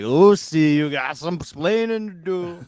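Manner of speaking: loud, high sing-out of a catchphrase; hammed-up exasperated sitcom husband; Ricky Ricardo. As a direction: call her name, then drag out the scolding. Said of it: "Ricky Ricardo was like".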